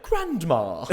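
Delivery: mock the slipped posh vowel loudly; mocking echo. Crew sniggers at the actor's slip, mocking echo. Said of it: "you just hear these sniggers".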